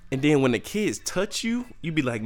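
ordinary delivery

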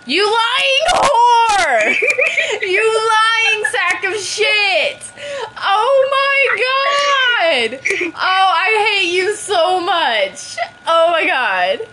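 high, drawn-out betrayed shriek; stretched-out, high-pitched outburst of betrayal; outraged shouting. Real, not acted. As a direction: wail accusations at the top of your voice. Betrayed by friend in game.

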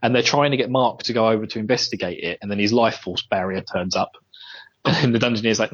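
normal talking voice

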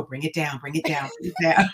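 soft, low-key calming repetition; calming self-talk. Retold or imitated. Adult reminding herself to stay composed with a child, softly calming. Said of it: "presence of mind to go".

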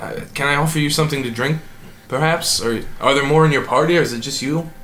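a nervous host fussing over guests; eager host. Acted.

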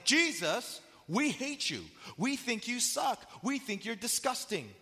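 high, loud, acted-out contempt; hateful jeering. Preacher voices haters insulting Jesus, hatefully. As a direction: hurl the insults, stretching them out.

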